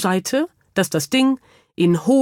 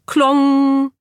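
high, drawn-out metallic klong; imitated clang; 7 semitones higher, 4 dB louder than usual. A narrator's reading. Narrator as the curtain rod crashes, imitated clang. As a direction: ring it out like a falling rod.

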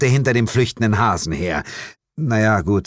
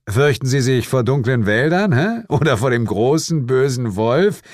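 higher-pitched, needling question; taunting questioning; 5 semitones higher, 3 dB louder than usual. A narrator's reading. Detective needles parents about their missing daughter, taunting. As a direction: goad them with mock concern.

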